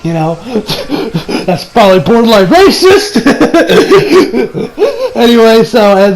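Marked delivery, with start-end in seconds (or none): 0.4-1.4 soft laugh. Real, not acted. Laughing at racist line. 3.2-5.1 high-pitched burst of laughter; a real laugh at the absurd line. Real, not acted.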